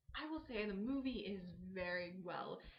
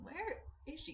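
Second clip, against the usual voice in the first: nervous searching; 9 semitones higher than usual. Retold or imitated. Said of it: "like, going".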